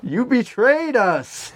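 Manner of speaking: high, slow accusation; hammed-up betrayed fan; mock betrayal.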